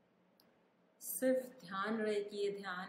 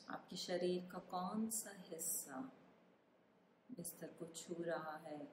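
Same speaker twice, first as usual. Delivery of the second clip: calm meditation guide, unhurried with long pauses; guided meditation; 4 dB quieter than usual. Acted. Meditation guide asking listeners to feel their body, calm guided meditation. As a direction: ease the listener through a body scan, phrase by phrase.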